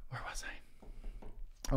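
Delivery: soft slow whisper, voice gone; whisper to self. Real, not acted. Back from the door, whispering to himself. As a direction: breathe it to yourself, slowly. Muttering to self after interruption.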